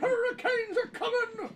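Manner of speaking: movie quote. Retold or imitated. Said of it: "Can we quote Little Mermaid?".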